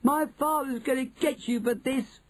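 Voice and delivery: squealed, high-pitched outrage; shrieking. A narrator's reading. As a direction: shriek a threat like a tattling child.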